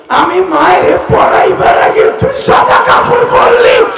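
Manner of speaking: high, loud wailing cry of a grieving mother. Retold or imitated. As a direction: wail for the dead son at the top of the voice. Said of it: "চিল্লায়া কান্দে আর বলে".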